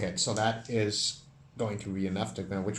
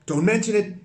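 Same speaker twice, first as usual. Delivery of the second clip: much higher and louder elf reply; 11 semitones higher, 9 dB louder than usual. Acted. Elf answering the thanks, high and cocky. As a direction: toss off the thanks like a proud elf. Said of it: "says the elf".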